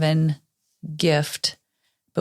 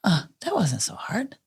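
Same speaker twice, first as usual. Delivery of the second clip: whispered thought; 4 semitones higher, 3 dB quieter than usual. Retold or imitated. Imagined relieved thought.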